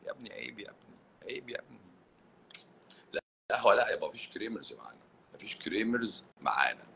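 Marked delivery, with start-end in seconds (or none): 0.1-1.8 hushed scolding. Pretend. Mock scolding a viewer.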